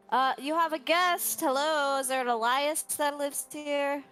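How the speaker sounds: calling out. Acted. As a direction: call to whoever might be inside. Calls through closed door to stranger.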